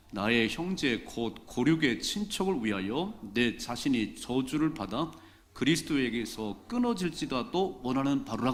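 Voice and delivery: slow, measured congregational Bible reading; deliberate, projected recitation of scripture. Acted. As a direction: lead the congregation in reading aloud, phrase by phrase.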